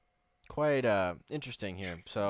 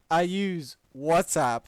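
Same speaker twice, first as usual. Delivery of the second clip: higher, louder and slow; mock reading; 3 semitones higher, 7 dB louder than usual. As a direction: ham up the reply. Reads the reply mockingly.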